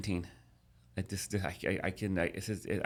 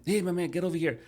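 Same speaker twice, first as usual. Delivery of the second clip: security guard; 10 semitones higher, 3 dB louder than usual. Retold or imitated. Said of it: "security guard comes running in".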